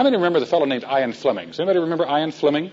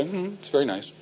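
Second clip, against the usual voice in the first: unimpressed murmur; 9 dB quieter than usual. Retold or imitated. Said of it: "And he'd say".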